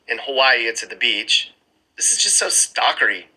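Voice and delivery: ordinary talk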